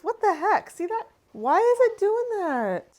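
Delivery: high, loud, slowing into a whine; baffled exasperation. Real, not acted. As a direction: plead with the cheese in disbelief. Cheese cracking again.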